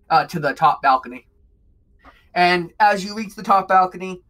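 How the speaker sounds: ordinary talk, nothing marked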